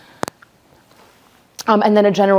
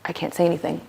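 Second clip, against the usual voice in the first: quiet frozen; 7 dB quieter, faster than usual. Retold or imitated. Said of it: "You're just".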